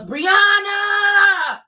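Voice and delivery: high, loud sharp call of her name; Remy shouting to cut her off. Retold or imitated. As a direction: yell the name to stop her.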